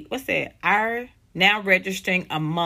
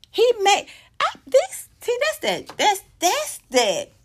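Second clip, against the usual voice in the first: excited; 13 semitones higher than usual. Real, not acted. Excited outburst.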